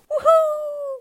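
very high gleeful whoop; joyful cry. Acted. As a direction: whoop like a chick taking flight. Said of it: "cried little Pip-Pip".

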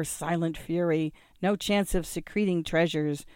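ordinary delivery

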